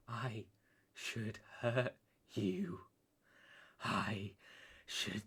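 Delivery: very soft, low, slow menace; quiet threat. Acted. Betrayed man threatening his captive former lover, quiet threat. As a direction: threaten softly, spacing out each word.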